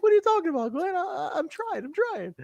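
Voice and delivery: very high, pleading put-on voice; David Young impression. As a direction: plead helplessly in a small falsetto. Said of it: "And he's like".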